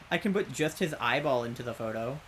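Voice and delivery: ordinary talk, nothing marked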